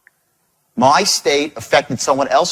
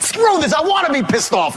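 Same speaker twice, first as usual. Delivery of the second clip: high, defiant retold outburst; pitched-up petulant fury; really angry; 9 semitones higher than usual. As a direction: refuse to calm down, acting it out. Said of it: "I'm really angry".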